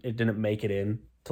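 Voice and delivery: usual speaking voice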